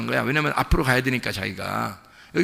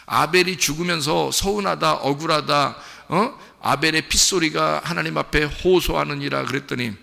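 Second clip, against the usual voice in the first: ordinary talk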